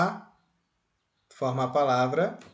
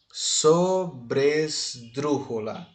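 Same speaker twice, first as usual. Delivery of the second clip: "sobresdrújula" very slow, each syllable set apart; much slower than usual. Shown as a demonstration. Teacher classifying the word for students, syllable by syllable. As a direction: sound out "sobresdrújula" slowly in separate syllables.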